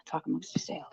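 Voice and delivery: unvoiced whisper, pleading softly. Real, not acted. Streamer begging chat to wait, whispering. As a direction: lean in and whisper the plea.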